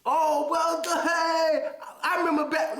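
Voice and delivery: lightly voiced fake-friendly brother; a hint of the phony old friend; mock hater greeting. Imitating a hater greeting him to bring up his past. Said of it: "somebody come up to you going".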